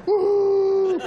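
high, loud woo-hoo cheer; gleeful whoop. Acted. Couple celebrating their hidden brownies, high loud whoop.

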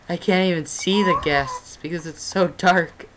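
ordinary talk, nothing marked

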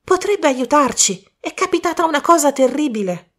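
a worried youth bursting in; young man Colin. Acted.

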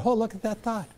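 soft, detached observing, lightly amused; gentle mock-marvel at a passing thought; calm noticing voice. Shown as a demonstration. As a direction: watch the thought drift by. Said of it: "that's what noticing your thoughts are".

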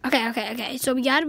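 normal talking voice

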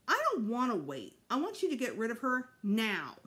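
put-on little boy insisting crossly; petulant demand. A narrator's reading. Boy demanding mother lose the baby, petulant demand.

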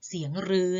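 รือ with a long "ue" vowel; long rue sound. Shown as a demonstration. Teacher tells pupils the only sound of ฦ, long รือ. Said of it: "ออกเสียงได้เพียงอย่างเดียว".

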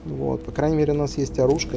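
normal talking voice

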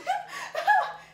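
squealing laugh at herself; high squeal of a laugh at looking silly; high burst of laughter. Real, not acted.

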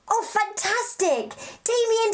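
ordinary talk, nothing marked

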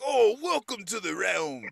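mock medieval accent. Imitating the over-the-top guide in Role Models, slow and grand. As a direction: drawl it like a hammy Renaissance-fair actor. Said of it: "he was talking like that".

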